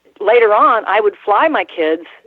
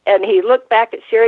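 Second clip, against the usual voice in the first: usual speaking voice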